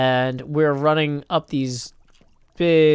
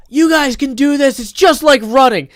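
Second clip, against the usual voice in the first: shouted pep talk, as a coach; coach's shout; 12 semitones higher, 11 dB louder than usual. Retold or imitated. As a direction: imitate a coach calling to the team, loud and high. Acts coach urging struggling swimmers.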